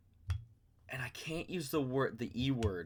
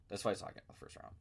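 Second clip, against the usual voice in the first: whisper; 3 semitones lower, 10 dB quieter, much faster than usual. Retold or imitated. Repeats the prediction to his friend.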